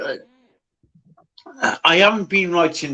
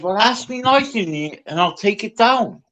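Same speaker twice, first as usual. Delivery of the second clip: mocking Jimmy; 9 semitones higher than usual. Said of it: "Jimmy said".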